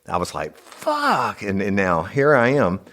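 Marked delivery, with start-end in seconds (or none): 0.6-1.4 very high, drawn-out scream of pain; high pained wail, acting it out; re-enacted pain scream. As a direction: scream the curse up high, imitating agony. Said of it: "I started screaming like a bitch".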